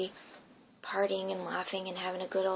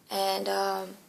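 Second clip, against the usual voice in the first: usual speaking voice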